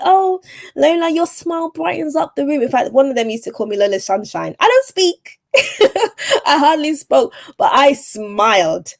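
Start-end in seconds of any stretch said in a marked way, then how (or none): 0.0-2.6 high, sing-out mock gushing; gushing compliment. Colleagues admiring her smile, imitated in a high gush. 4.6-8.9 high-pitched, laughing protest; amused disbelief. Real, not acted. Speaker laughing at her sunny nickname, high amused protest.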